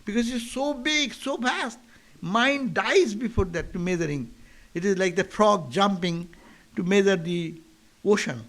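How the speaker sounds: ordinary talk, nothing marked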